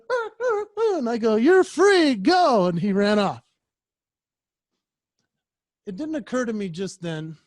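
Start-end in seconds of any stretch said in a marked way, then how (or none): 0.1-1.0 high imitated little dog whines, much higher; tiny squeaky ah ah whines; dog whimpering. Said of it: "he was going". 1.3-2.7 much higher, louder cheerful send-off; bright loud you're free to a pup; releasing a dog. Retold or imitated.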